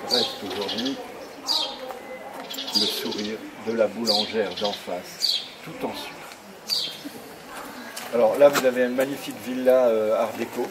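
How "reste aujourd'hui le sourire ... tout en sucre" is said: poem recitation. Acted. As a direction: savour each line of your own verse. Recites his poem on tour.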